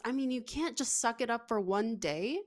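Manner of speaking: ordinary delivery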